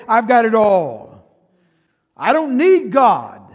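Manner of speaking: slow, loud, smug boast; mock the proud church's self-satisfied claim; conceited. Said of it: "they very conceitingly say".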